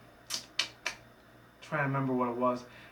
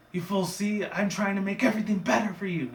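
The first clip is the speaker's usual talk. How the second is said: higher-pitched self-justification; lightly voiced villain; 7 semitones higher than usual. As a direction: lightly act an exasperated leader. Villain leader justifying herself.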